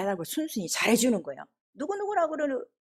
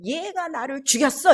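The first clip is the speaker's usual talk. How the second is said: slow, higher, louder spirit accusation; accusing spirit; 4 semitones higher, 6 dB louder than usual. Retold or imitated. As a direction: voice a spirit accusing its killer, drawn out. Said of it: "그때 하는 말이 뭔가 하면". (귀신이 고발하는 말투 흉내)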